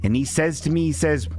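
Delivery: ordinary talk, nothing marked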